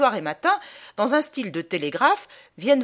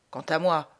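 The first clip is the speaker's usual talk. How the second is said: ordinary delivery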